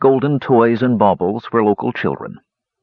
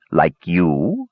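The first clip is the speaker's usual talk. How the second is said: dry mocking jab; sarcastic snort; 3 semitones lower than usual. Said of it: "Lodestone snorted sarcastically".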